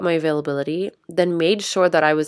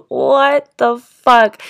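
slow, drawn-out, louder and higher; stunned disbelief; 5 semitones higher, 6 dB louder, much slower than usual. Retold or imitated. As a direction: imitate a flabbergasted friend, stretching every word. Friend stunned by sudden mood change.

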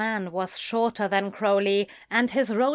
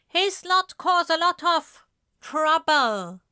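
a sneering warning, pitched higher; 8 semitones higher, 3 dB louder than usual. Acted. Stranger sneering at the bodyguard before leaving. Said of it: "a full sneer".

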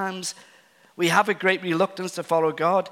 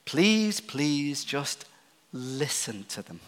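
soft plea; 4 semitones lower, 6 dB quieter than usual. Real, not acted. Preacher pleading with listeners to just listen, softly. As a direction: lean in and ask gently.

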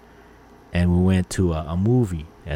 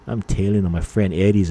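ordinary talk, nothing marked

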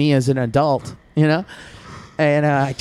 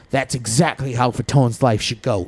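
ordinary delivery

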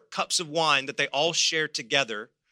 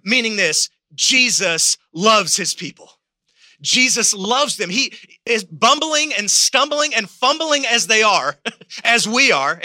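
louder, rising revival preaching; full-force preaching; 6 semitones higher, 6 dB louder than usual. Acted. Pastor stirring the church family, preaching at full force.

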